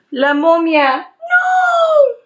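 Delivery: high gasp, then a shrieked no; sudden dramatic scream at something she sees; mock horror. Real, not acted. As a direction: fake panic thrown into a makeup tutorial. Sudden gasp and shout in Spanish.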